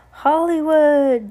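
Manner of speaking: high, slow, drawn-out call. A narrator's reading. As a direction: hail him from the cage. Boy calls out nickname.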